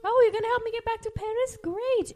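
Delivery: high, quick and sweetly sarcastic; a prim lady cat playing along; Duchess voice. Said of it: "she was like".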